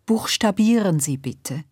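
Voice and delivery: ordinary delivery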